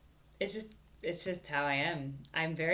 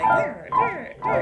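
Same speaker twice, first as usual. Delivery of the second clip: loud, high trilled sweeps; loud high durr noises; imitated glissandos; 13 dB louder, much slower than usual. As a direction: mimic keyboard slides in squealing bursts. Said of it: "he was like".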